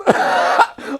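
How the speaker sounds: high breathy burst of laughter. Real, not acted. Narrator cracking up at Escobar's disbelief, high laugh.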